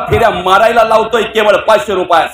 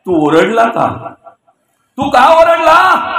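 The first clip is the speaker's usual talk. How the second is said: slow asking; slower than usual. Acted. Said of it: "बाहेरचा विचारतोय".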